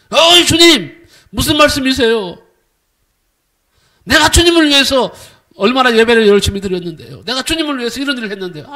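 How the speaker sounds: 크고 높게 터져 나와 점점 빨라지는 항변; 억울한 신자처럼 주님께 따져 묻기; 항의하듯. Acted. 신자가 주님께 항변하는 장면. (큰 소리로 억울하게 항변하듯)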